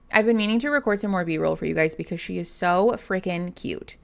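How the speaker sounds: ordinary talk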